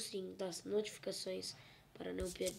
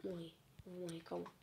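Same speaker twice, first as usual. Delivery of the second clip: normal talking voice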